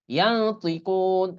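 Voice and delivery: ইয়ানতিকুন very slow, ন as nasal ইয়াং; slow ikhfa demo. Shown as a demonstration. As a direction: stretch ইয়াং before তি. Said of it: "ইয়াং হয়". (ধীরে গুন্নাসহ ইয়ানতিকুন)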